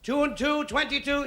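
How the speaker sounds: bingo call. Retold or imitated. Bingo caller calling numbers.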